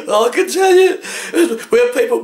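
high, laughing, nearly crying; laughing hard. Real, not acted. Butcher still laughing at the story, words squeezed through laughter.

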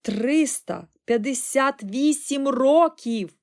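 loud, high, drawn-out astonishment; stunned exclamation. A narrator's reading. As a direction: cry out the number, staggered. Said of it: "вигукнув ошелешений пан Бакет".

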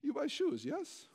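a hint of a pushy Russian shop clerk; Russian woman. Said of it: "And she says".

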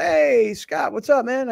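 high-pitched, drawn-out mock greeting; imitated friendly hail. Dog walker hailing the radio host, high eager imitation. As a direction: call out like a keen fan. Voices stranger greeting him on walk.

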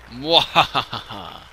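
slow loud evil laugh; mad scientist laugh. Acted. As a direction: cackle like a villain. Villain laugh.

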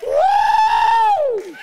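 very high, loud ecstatic whoop; Holy Ghost whoop. Real, not acted. Preacher letting off Holy Ghost steam, whooping. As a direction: let out a joyful shout of steam. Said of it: "a little bit of Holy Ghost steam".

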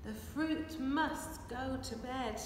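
ordinary delivery, nothing marked

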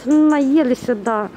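usual speaking voice

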